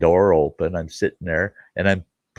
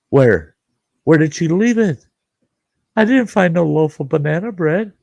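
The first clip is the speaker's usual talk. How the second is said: high-pitched, disbelieving questioning; comic indignant bewilderment; incredulous; 9 semitones higher, 4 dB louder than usual. Retold or imitated. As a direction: re-enact dismay at a missing gift, voice climbing high. Retold shock at missing gift.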